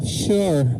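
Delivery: ordinary delivery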